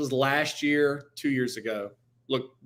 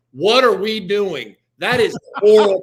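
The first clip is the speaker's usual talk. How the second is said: loud, higher imitation of a ranting coach; outraged coach yell; 5 semitones higher, 9 dB louder than usual. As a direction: holler it down the sideline in mock outrage. Coach yelling at opposing coach, retold.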